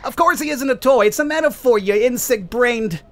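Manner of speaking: high, sneering villain outburst; contemptuous villain. Acted. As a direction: scoff down at the fool. Mormo snaps at the hero.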